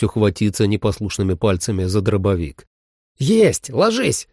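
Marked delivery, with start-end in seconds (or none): 3.2-4.3 shouting woman. Acted. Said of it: "крикнула Холли".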